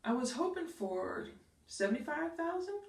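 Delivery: rising like a question; hesitant, asking permission; unsure upward lilt on the number; weak, unsure. Shown as a demonstration. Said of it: "first a weak statement".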